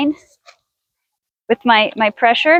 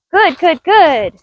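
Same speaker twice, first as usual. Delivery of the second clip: higher, eager good good good; bright praise; 6 semitones higher, 3 dB louder, much slower than usual. Real, not acted. Trainer praising mare in the round pen, eager praise. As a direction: cheer the mare on as she comes. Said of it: "praising the horse".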